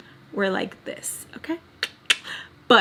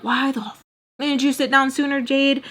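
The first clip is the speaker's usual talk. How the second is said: higher-pitched, mildly exasperated telling-off; self-scolding; 5 semitones higher than usual. Acted. Vlogger telling herself off for sitting down late, self-scolding.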